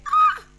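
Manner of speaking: sudden high squeal of delight; excited squeal. Retold or imitated. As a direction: shriek with giddy joy. Squeal at lunch invite.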